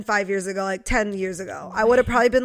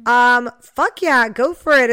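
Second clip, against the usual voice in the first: enthusiastic; 10 dB louder, slower than usual. Retold or imitated. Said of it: "And new me".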